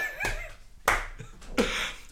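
high, breathless laughing fit. Real, not acted. Laughing at the retold fight, high helpless laughter.

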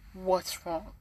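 soft, caring question; gentle concern. Acted. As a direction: ask quietly, ready to listen. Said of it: "Like, listening".

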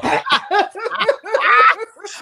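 big burst of laughter; loud laugh. Real, not acted. Laughing at hearing music.

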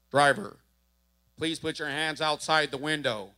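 a hint of a stern cop barking orders; police command. Officer ordering the driver out, mild stern cop command. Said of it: "all you hear was".

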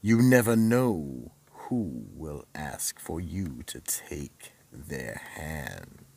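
ominous slow warning; horror host. A narrator's reading. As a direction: warn the audience with creepy relish. Horror host moral over music.

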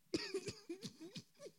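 high burst of laughter. Real, not acted. Vlogger cracking up at her own joke, high laughter.

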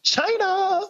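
very high mock squeak; squeaky high China. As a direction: squeak it in disbelief. Echoing in mock disbelief.

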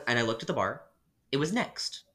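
ordinary talk

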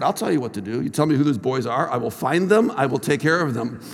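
low, quiet protective threat; coach's menace held down low and soft; retold controlled anger. Teacher to hurt student about her bullies, quietly angry. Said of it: "I'm already getting angry".

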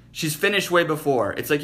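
normal talking voice

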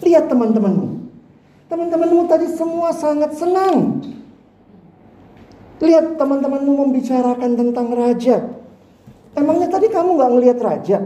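put-on teacher questioning a sad child; puzzled teacher. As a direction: ask the crying pupil kindly why. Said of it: "gurunya bilang".

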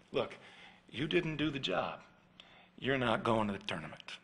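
soft, low, grim pronouncement; quiet final verdict. Retold or imitated. Dad taking tractor keys from son, softly and grim. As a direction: deliver the punishment quietly.

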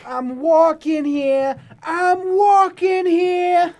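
New York movie yell. Pretend. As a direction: yell it at a taxi in Manhattan. New York movie line impression.

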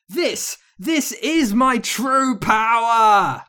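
high, slow, building to a drawn-out shout; hammed-up wicked witch triumph; evil witch boast. A narrator's reading. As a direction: roar it like a triumphant storybook villain. Witch villain revealing her power.

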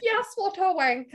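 high-pitched imitation of a British insult; mock British accent. Agreeing with British TV's cussing, high mock British accent. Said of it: "only say it with a British accent".

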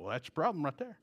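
lightly voiced trooper, kept quiet; a hint of a state trooper, low-key; [name] in state trooper mode. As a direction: imitate a trooper with soft understatement. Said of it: "went back into state trooper mode".

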